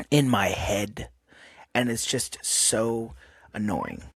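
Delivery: ordinary delivery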